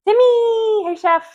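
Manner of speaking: loud, high call across the room. Real, not acted. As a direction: shout the name to get attention. Calling out to someone.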